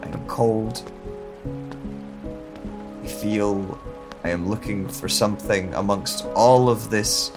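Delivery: usual speaking voice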